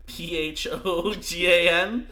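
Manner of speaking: spelled out slowly, letter by letter; slow spelling. Real, not acted. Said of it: "I'm glad that you actually say it that way".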